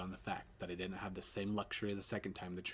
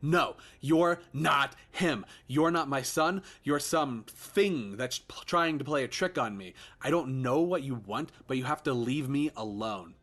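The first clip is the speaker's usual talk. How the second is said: desperate refusal; 6 semitones higher, 8 dB louder than usual. Acted. Father refuses ghost.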